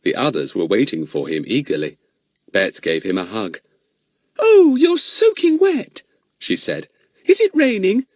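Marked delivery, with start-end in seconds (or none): 4.4-8.1 put-on concerned girl voice. A narrator's reading. Betts worried at wet Fatty.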